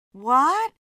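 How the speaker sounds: loud, high, rising "what?"; suspicious. A narrator's reading. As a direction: pounce on it as a sharp question. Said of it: "Beth eyed him suspiciously".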